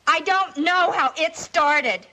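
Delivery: pleading, losing control as she denies it; panicked denial. Acted. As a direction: voice climbing into frantic high panic. Accused girl breaks down pleading.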